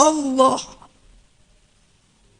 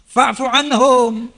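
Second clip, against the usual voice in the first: slow, chanted Arabic verse; Quranic recitation; 2 semitones higher, much slower than usual. Acted. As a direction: intone the verse slowly, reverently. (lantunan ayat perlahan)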